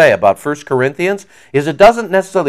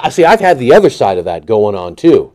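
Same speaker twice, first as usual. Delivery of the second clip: ordinary talk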